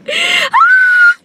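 very high, loud scream; freaked-out scream. Real, not acted. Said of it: "I'm really freaking out".

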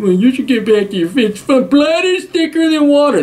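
high-pitched mock whiny plea; mocking guilt-tripping relatives. Relatives pressing someone to pay up, mocked guilt trip. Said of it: "They pull that bullshit on you".

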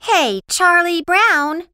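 high, loud, excited hail; happy call. A narrator's reading. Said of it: "Patty was happy".

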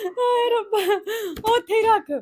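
high wailing exclamation. Real, not acted. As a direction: wail it out like a dramatic aunty. Dramatic wail about evil eye.

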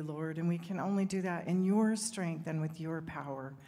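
ordinary delivery

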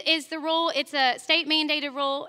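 ordinary talk, nothing marked

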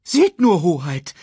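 high, loud bird alarm; excited bird. A narrator's reading. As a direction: point it out like an agitated hornbill. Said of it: "er deutete mit einem Flügel". (aufgeregter Vogel, hoch und laut)

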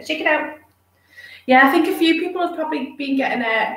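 ordinary talk, nothing marked

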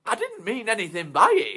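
high, loud pleading; nervous karaoke bloke. Acted. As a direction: protest weakly.

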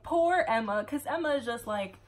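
ordinary talk, nothing marked

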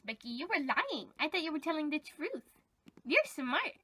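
ordinary talk, nothing marked

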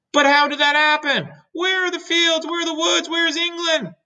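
very high, panicky string of questions; alarmed questioning. Acted. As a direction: wail each question in bewilderment. Bewildered creatures lose sight of land.

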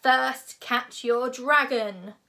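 loud, slow, booming announcement; dramatic bellow, drawn out; dramatic proclamation. A narrator's reading. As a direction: proclaim it grandly after a pause. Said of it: "Gobber paused dramatically".

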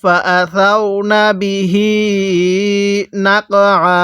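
measured chant with the last "hee" of বিহি held; slow Quran recitation. Shown as a demonstration. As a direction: recite slowly, stretching the end of বিহি. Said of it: "পড়ুন".

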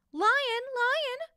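high, enchanted little bird call; a high, chirpy bird voice, enchanted; enchanted chirp. A narrator's reading. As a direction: sing out his name as if charmed. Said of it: "the bird chirped, enchanted".

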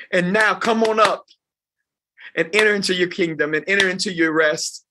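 rousing preacher's call of well done; preaching climax. Acted. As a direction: build the sermon to its peak. Sermon rises to its climax.

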